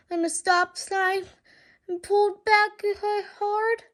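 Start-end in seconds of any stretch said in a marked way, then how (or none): none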